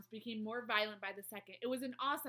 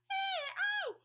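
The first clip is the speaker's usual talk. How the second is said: very high, loud howl; roar of pain; 10 dB louder than usual. A narrator's reading. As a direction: shriek it out like a tormented giant. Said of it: "roared the flesh lump eater".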